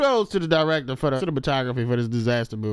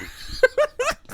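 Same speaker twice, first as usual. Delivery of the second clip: high squeal laugh. Real, not acted. Big laugh.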